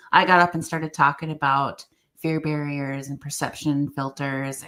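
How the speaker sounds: ordinary delivery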